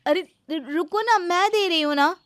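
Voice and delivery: urgent call to wait; calling after her. Acted. Bride calls after leaving seller.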